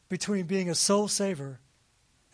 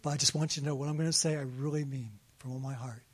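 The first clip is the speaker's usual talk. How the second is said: gentle confession; 5 semitones lower, 6 dB quieter, faster than usual. Retold or imitated. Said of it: "I said".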